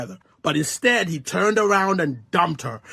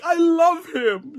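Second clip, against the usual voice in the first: mock tearful; 9 semitones higher, 3 dB louder, slower than usual. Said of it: "she's crying".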